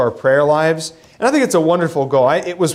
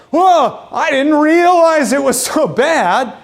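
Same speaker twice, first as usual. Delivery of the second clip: high, louder, slow hammed-up alarm; mock panic; 8 semitones higher, 4 dB louder, slower than usual. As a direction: fake a startled panic. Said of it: "he didn't go into a panic like".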